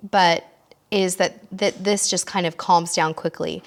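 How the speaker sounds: ordinary talk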